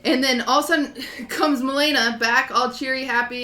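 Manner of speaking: ordinary talk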